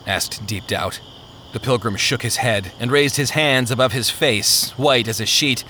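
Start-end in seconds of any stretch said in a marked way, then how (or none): none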